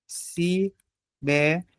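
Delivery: Sibéria slowly broken into separate beats, Si, bé, ri, a; soft, spaced-out syllables in Sibéria. Shown as a demonstration. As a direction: count out Sibéria with pauses between syllables. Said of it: "Vamos fazer a separação silábica".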